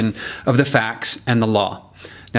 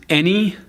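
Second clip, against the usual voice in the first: higher-pitched, stressed "any"; emphasis on any; 6 semitones higher than usual. Shown as a demonstration. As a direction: punch the word "any" with raised pitch. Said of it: "place your emphasis on the words".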